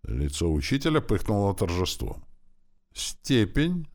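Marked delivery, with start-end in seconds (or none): 2.9-3.9 high, tentative questioning guess; very unsure. A narrator's reading. Pupil guessing the answer for his teacher, very unsure. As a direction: venture it as a nervous guess. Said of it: "очень неуверенно, тихим голосом".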